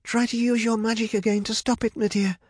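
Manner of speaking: high-pitched urgent pleading; begging. A narrator's reading. Rapunzel urges Medea to use magic, begging. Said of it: "begged Rapunzel".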